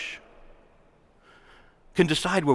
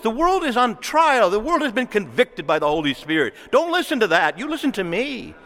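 louder, higher commanding plea; preacher voicing the Lord, insistent and loud; the Lord warning; 7 semitones higher, 4 dB louder than usual. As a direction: voice God's urgent demand. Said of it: "He's saying".

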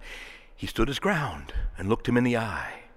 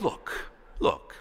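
hushed, gentle look, look; calm them down softly; 7 semitones lower, 6 dB quieter than usual. Retold or imitated. The Lord calming a hurried driver, hushed. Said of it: "the Lord says".

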